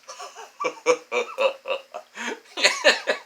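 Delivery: big goofy guffaw climbing high; hammed-up oafish laughter; Herman Munster laugh. Boasting to a guy on the bench, goofy guffaw. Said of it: "I'm like fucking Herman Munster".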